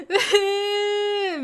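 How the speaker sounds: exaggerated slow wailing sob; wailing sob demo. Shown as a demonstration. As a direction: demonstrate bawling like a child. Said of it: "みたいに".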